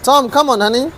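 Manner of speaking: high coaxing pet call. Real, not acted. Calling the pet again.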